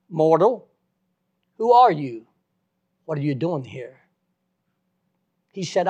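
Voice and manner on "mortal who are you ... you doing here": the same booming call a little less loud, loud on who are you; God's voice asking again. Retold or imitated. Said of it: "I heard that voice again".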